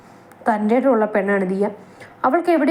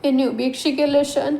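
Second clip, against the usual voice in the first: tearful plea; 6 semitones higher than usual. Acted. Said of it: "അവൾ കണ്ണീരോടെ".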